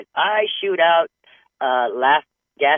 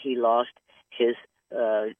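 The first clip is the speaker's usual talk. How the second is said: ordinary talk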